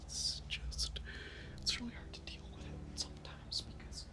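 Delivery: breathy whisper, barely audible. Real, not acted. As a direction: confide it under your breath. Sad venting in a whisper.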